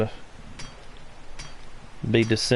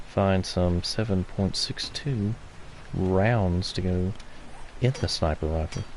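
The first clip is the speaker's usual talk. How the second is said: golf commentator; 5 dB quieter than usual. Pretend. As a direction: murmur like a golf broadcaster. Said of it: "golf commentary voice".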